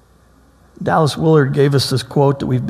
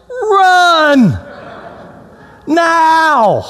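hammed-up commanding shout; best pastoral voice; 10 semitones higher than usual. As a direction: yell at the dawdling group to hurry. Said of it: "I say in my best pastoral voice".

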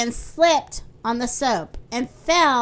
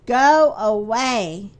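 slow, low groan; low, drawn-out dismissal; 4 semitones lower, 4 dB louder, slower than usual. A narrator's reading. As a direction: groan it out, dragging each word. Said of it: "he groaned".

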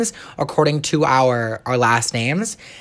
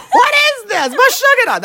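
much higher laughing shout of baffled relatives; Italian relatives; 3 dB louder than usual. Retold or imitated. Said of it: "They're all Italian".

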